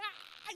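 high-pitched mimicked shriek of laughter; mock squeal of helpless laughter; imitated laughing squeal. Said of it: "She's laughing".

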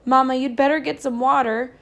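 mother yelling to grandmother while running to the barn; yelled. A narrator's reading. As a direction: call back urgently while running. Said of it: "she yelled over her shoulder".

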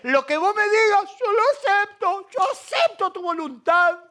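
high, mock-meek voice of a falsely humble believer; a man faking humility to God, high mock-meek voice; fake humble. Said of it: "el otro que se disfraza de humilde".

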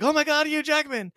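high, loud fan squeal imitation; mock starstruck excitement. Podcaster imitating crowds recognizing a star, in mock starstruck excitement. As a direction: gush like a fan spotting a celebrity.

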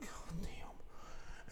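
breathy stunned whisper; whispered curse. Retold or imitated. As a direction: mutter it under your breath in dismay. Sees thief on camera.

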